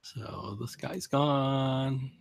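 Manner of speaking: normal talking voice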